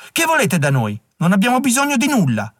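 high-pitched, hostile challenge; wary, angry rebuff of a stranger; defensive snarl. A narrator's reading. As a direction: snarl at an intruder, rising in pitch. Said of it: "ringhiò".

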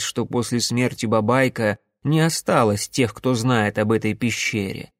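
plain reading voice

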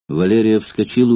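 ordinary delivery, nothing marked

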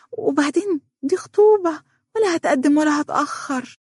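crying as she pleads; voice breaking. Acted. Donia tearfully defending the engagement to her mother.

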